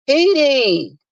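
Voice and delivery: high-pitched mock whine; whining upper register. Mimicking Ness calling out, high upper-register whine. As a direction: call it out in an imitated high whine. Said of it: "this whining voice that Ness goes into that upper register".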